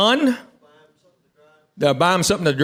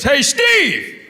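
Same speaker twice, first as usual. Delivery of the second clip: high holler from another guy; imitated calling out; 7 semitones higher than usual. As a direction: yell the name across the crowd. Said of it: "Another guy goes".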